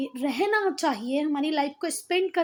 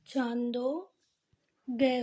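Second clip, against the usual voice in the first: lower, softer, drawn-out Chandogya; slow model reading; 3 semitones lower, 4 dB quieter, much slower than usual. Shown as a demonstration. Teacher dictating the name to students, slowly and softly. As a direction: stretch Chandogya out with a long "aa". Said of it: "पर बोलना छांदोग्य ही".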